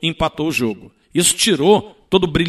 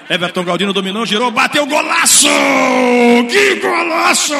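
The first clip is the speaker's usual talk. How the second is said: screaming goal call, stretched high; explosive goal commentary; 10 semitones higher, 4 dB louder, slower than usual. Real, not acted. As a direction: roar the goal like a radio narrator. Radio goal call, shouted.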